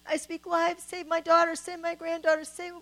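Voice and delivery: retold desperate crying out. Mother retelling how she begged Jesus to save her daughter, frantic high loud prayer. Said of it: "I just started screaming".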